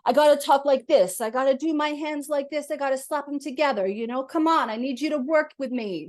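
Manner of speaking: hammed-up pushy grandfather; boisterous grandfather. Medium channeling a grandfather, brash and hands-on. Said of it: "a father or grandfather the other day".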